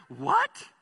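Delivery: high, rising, baffled yelp. Retold or imitated. Preacher acts a worker puzzled by the born-again question, baffled.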